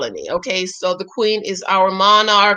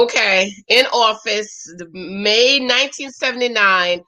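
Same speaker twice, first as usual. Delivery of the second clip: ordinary talk, nothing marked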